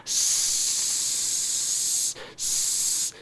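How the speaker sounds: stuck on a hissing S; stutter. Shown as a demonstration. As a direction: hiss the s, unable to get the word out. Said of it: "I'd be like".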